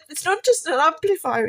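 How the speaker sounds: put-on a boy proudly setting mum straight; excited son. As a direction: son insisting to mum, thrilled. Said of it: "he said".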